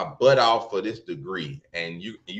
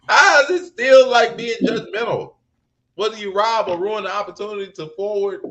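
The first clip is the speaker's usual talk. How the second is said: much higher and louder, laughing indignation; heated disbelief; 11 semitones higher, 6 dB louder than usual. Real, not acted. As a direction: rant like you can't believe it. Heated argument about the culture.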